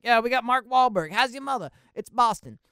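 put-on loud, high parody Boston accent; mocking a movie's overdone Boston accent, loud and high. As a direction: shout it like a cartoon Boston tough guy. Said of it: "The Departed kinda has an issue with that".

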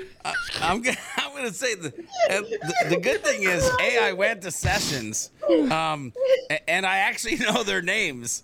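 can't stop laughing while answering. Real, not acted. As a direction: still laughing, high and giddy. Laughing after the story.

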